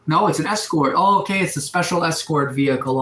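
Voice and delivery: usual speaking voice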